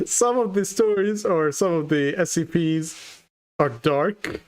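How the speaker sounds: normal talking voice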